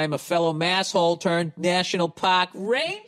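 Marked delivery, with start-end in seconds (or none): 2.1-3.0 put-on higher Boston accent, rising on the end; hammed-up Boston "pahk rangah". Fellow masshole, Boston-style ending.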